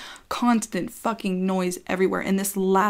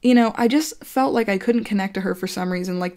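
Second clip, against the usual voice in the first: usual speaking voice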